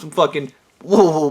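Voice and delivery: usual speaking voice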